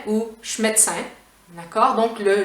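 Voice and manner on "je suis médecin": "je suis" reduced to a bare "ch" before "médecin"; shortest Quebec contraction. Shown as a demonstration. Teacher giving learners a second option, fully reduced pronunciation. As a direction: drop the vowels of "je suis", leaving "ch'médecin". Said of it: "on va dire".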